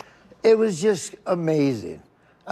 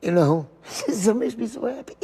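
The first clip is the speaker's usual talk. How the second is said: tearful laughing; 5 semitones higher than usual. Real, not acted. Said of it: "I get happy and I get emotional".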